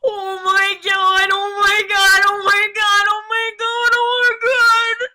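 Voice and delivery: loud, high, drawn-out wailing cry; anguished repeated cry; high horrible call. A narrator's reading. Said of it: "his high, horrible call".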